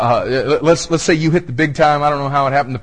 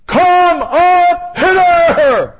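very high, loud, drawn-out shout; commanding shout; 8 dB louder, much slower than usual. Acted. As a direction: shout it slowly, stretching every word. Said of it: "you know what that shout is".

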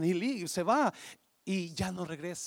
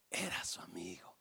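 soft lower whisper of recognition; hushed whisper; 10 semitones lower, 7 dB quieter than usual. Acted. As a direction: breathe it like a secret. El árbol reconoce al amigo.

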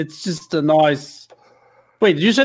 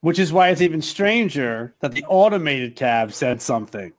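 ordinary talk, nothing marked

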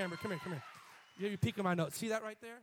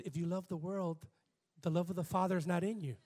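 a lightly voiced sweet, softer reading; 4 semitones lower, 4 dB quieter than usual. Pastor quoting Amber's reading to the congregation, lightly sweet and softer. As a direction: soften it, as gentle Amber would. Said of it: "In her sweet voice".

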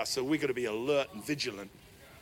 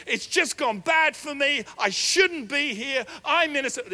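higher, louder self-pitying complaint; whining Joseph; 10 semitones higher, 7 dB louder than usual. Retold or imitated. Preacher voicing Joseph begging the butler, high whining complaint. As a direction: moan like a wronged prisoner begging a favour. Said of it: "he's just saying one thing to you".

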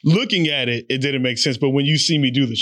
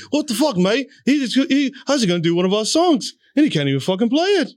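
put-on indignant British accent, pitched up; 5 semitones higher than usual. Oasis brothers watching his flubbed cover, British accent. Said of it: "That was my best British accent".

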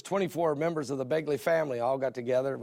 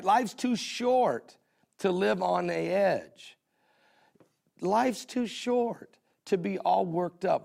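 drawn-out words, a bit higher; slow, preaching emphasis; 4 semitones higher, much slower than usual. Real, not acted. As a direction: lean on every word slowly. Preacher drives home the point.

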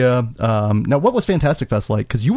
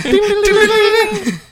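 squeaky game-show bell; high ding ding; 3 dB louder, slower than usual. Pretend. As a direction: chime it out very high.

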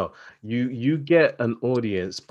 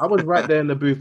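playful boast; 7 semitones higher, 6 dB louder than usual. Real, not acted. Playing along, louder.

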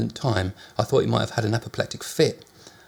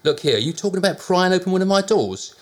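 indignant landlady; 9 semitones higher, 4 dB louder than usual. A narrator's reading. Landlady outraged at prying.

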